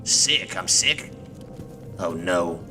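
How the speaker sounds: usual speaking voice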